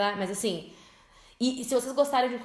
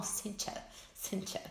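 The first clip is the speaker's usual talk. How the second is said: mock mispronunciation; 10 dB quieter, slower than usual. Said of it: "Eles falam".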